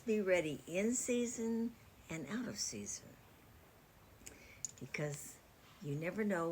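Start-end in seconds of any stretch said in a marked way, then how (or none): 0.0-3.1 whispered scripture. Retold or imitated. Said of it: "He says".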